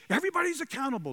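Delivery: high and fast, rushing it out; quick hushed command. Acted. Preacher voicing Jesus telling everyone they're accountable, high and fast.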